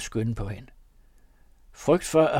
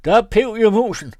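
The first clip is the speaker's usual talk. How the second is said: high, loud triumphant threat; shouting; 9 semitones higher, 11 dB louder than usual. A narrator's reading. Margrethe shouting at the house, loud. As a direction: crow over the scream, then threaten. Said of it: "lange Margrethe råbte".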